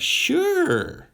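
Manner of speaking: very high, ditzy little yes; a falsetto shrug of a yes; squeaky Eve. Retold or imitated. Eve agreeing to the snake, very high and carefree.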